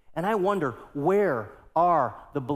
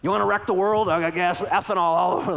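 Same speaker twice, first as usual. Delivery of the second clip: louder, higher mock rage; mock angry shout; 4 semitones higher, 5 dB louder than usual. Preacher joking about spraying the stranger with gas, mock angry shout. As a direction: ham up a comic threat.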